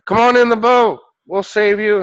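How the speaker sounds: loud, high distant hail; rescuer calling out. Acted. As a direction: shout up to someone on a roof. Boat rescuers calling to roof.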